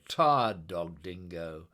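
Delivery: ordinary delivery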